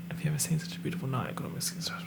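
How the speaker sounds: fast, breathy whispered reread. Pretend. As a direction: whisper it quickly to yourself. Lyric repeated in whisper.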